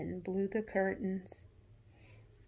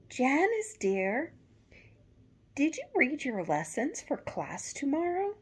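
higher, louder mother's call; a mother checking on her daughter; mom voice; 8 semitones higher, 6 dB louder than usual. A narrator's reading. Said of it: "she asked her daughter".

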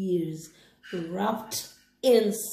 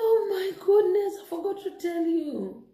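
surprised wife; 9 semitones higher than usual. Acted. Wife exclaims to husband.

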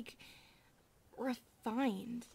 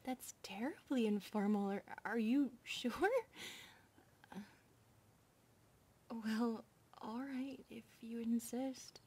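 hesitant whisper. Acted. Maid reluctantly agreeing to informal names, whispered.

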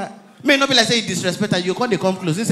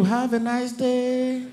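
slow polite officer; 6 dB quieter, much slower than usual. Retold or imitated. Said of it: "They go wave you".

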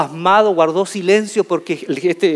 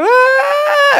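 put-on high loud wailing cry of a hurt child; 5 dB louder than usual. Boy bursting in bloody, wailing at home. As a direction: let out a piercing sobbing wail. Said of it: "abro la puerta llorando".

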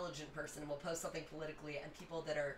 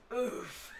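laughing oof. Retold or imitated. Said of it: "I was like".

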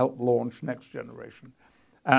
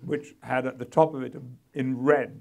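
ordinary talk

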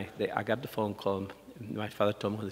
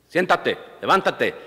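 loud barked Spanish orders, higher; drill sergeant commands; 5 semitones higher, 8 dB louder than usual. Retold or imitated. Spain Spanish speaker barking orders at you. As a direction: bark it like a first sergeant. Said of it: "like a first sergeant yelling a command at you".

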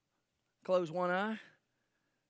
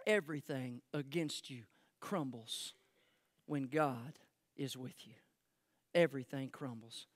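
hushed; 6 semitones lower, 6 dB quieter than usual. Real, not acted. Preacher's hushed key point.

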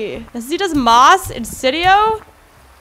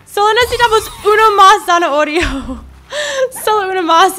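excited; 6 semitones higher, 5 dB louder, faster than usual. Real, not acted. Almost enough carrots.

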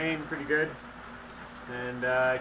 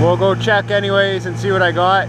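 loud, higher voice over engine noise; talking over noise; 4 semitones higher, 10 dB louder than usual. Real, not acted. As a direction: keep projecting over the motor. Talking over boat motor outdoors.

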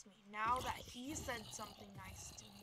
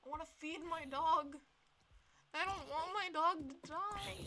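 higher, louder frantic pleading; panicked pleading; 5 semitones higher, 7 dB louder than usual. Real, not acted. Player fleeing danger, frantic louder pleading. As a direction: beg frantically.

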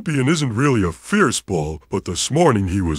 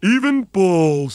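boastful Daisy; 9 semitones higher, slower than usual. Acted.

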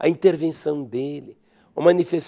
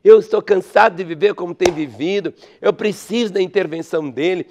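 desperate plea; 4 semitones higher, 6 dB louder than usual. Acted.

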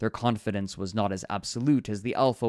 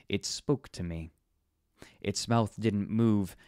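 plain reading voice

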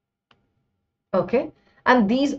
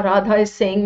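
normal talking voice

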